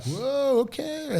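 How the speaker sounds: an impressed gasp of approval; impressed whoa. Retold or imitated. Fellow coaches react to his answers, impressed whoa.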